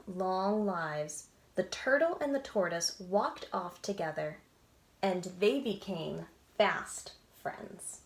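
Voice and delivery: lively storybook read-aloud with voices; animated picture-book narration; reading to children. A narrator's reading. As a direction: read it like bedtime story time.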